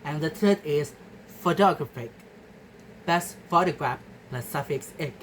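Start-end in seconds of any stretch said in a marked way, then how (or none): none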